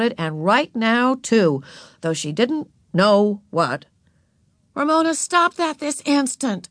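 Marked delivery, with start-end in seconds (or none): 4.7-6.7 put-on high, stern older-sister command; ordering. A narrator's reading. Beezus orders Ramona to stop her tantrum, sternly. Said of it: "Beezus ordered".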